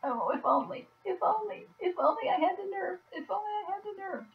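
high, whimpering, mock-tearful longing; hammed-up wistful whine; whiny mock lament. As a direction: imitate a timid viewer pining, fading to a whimper. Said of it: "you watch my videos and you go".

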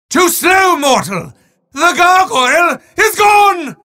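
booming wizard calling out game events; shouted evil-wizard game callouts; villain announcer. Acted. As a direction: taunt the player like a theatrical overlord.

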